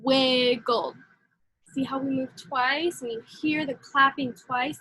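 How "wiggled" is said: wiggled mouthed in two clear parts; mouth method demo. Shown as a demonstration. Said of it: "Now do".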